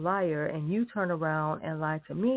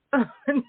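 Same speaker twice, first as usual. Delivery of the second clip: short laugh; 7 semitones higher, 3 dB louder than usual. Real, not acted. Laugh amid rant.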